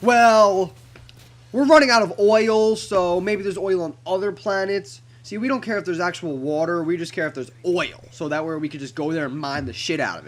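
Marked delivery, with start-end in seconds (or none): 0.0-5.0 mock greedy voice. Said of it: "It's actually more of a".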